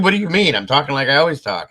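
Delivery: high, loud mock indignation; indignant protest. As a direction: protest as if wrongly accused. Acts out indignant denial to friends.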